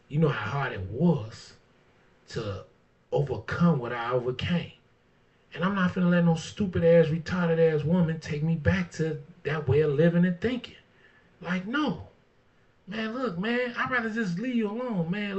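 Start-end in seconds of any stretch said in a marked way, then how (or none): 5.5-15.4 heated vow. Real, not acted. Vows not to go back.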